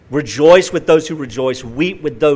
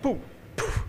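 whispered poof; 10 semitones higher, 11 dB quieter than usual. Retold or imitated. Mimicking ball hitting bird.

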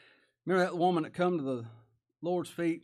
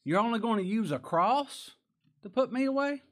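loud, climbing incredulous question; high, scornful astonishment; appalled disbelief; 8 semitones higher, 5 dB louder than usual. Acted. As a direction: act affronted at so feeble a challenge. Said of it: "just appalled at this".

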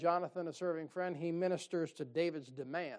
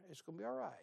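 soft reassurance; 2 semitones higher, 9 dB quieter, much faster than usual. Shown as a demonstration. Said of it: "Jonathan's basically saying here".

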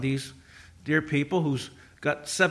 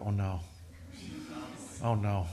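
low, hushed sinking dread; quiet dread; 7 semitones lower, 9 dB quieter than usual. Acted. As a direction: murmur it low, bracing for embarrassment. Dreading what deaf father will say.

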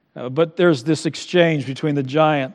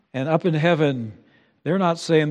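ordinary talk

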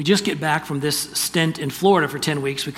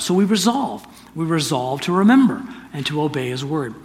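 preaching resolve; 4 dB louder than usual. Acted.

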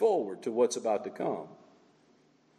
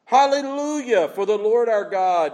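loud, high proclamation, easing slightly; crying out in praise; 5 semitones higher, 6 dB louder than usual. Acted. Preacher calling people to praise him, crying out. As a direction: belt it out as jubilant worship. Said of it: "And they're crying out".

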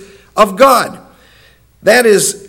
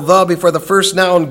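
ordinary talk

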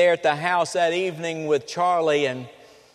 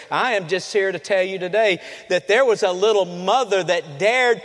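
louder, higher sermon build to the congregation; preaching at full force; 3 semitones higher, 4 dB louder than usual. Acted. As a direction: drive it home to the church.

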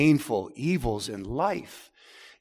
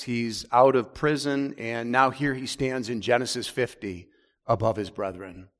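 usual speaking voice

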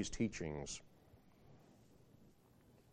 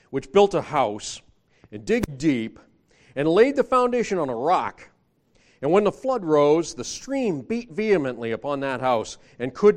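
loud, rising preacher reading; booming pulpit reading of the parable; scripture reading; 3 semitones higher, 7 dB louder than usual. Acted. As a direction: build the parable up to the flood. Reads scripture aloud.

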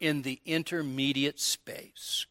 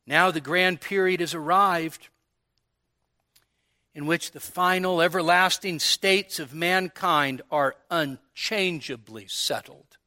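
ordinary delivery

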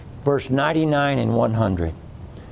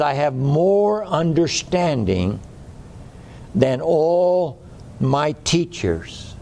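slow emphatic reading; slower than usual. Real, not acted.

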